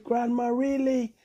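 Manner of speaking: put-on high, pleading child voice; protesting monkey. A narrator's reading. Bonobo to Grandma insisting, high and pleading. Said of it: "Bonobo said".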